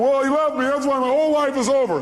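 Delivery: high, loud hammed-up lament; mock despairing whine. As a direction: whine it as the abandoned victim. Voicing someone despairing over breakup.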